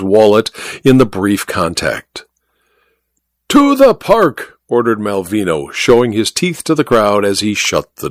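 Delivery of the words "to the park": high, slow command to the driver; imperious order. A narrator's reading. Magician commanding the cab driver, imperious.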